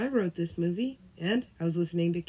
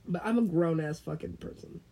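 normal talking voice

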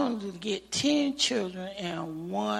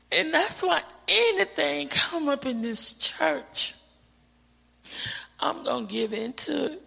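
ordinary talk, nothing marked

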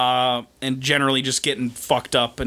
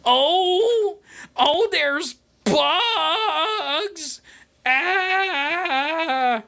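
shrieking hysterical woman; 13 semitones higher, much slower than usual. Retold or imitated. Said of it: "she's like".

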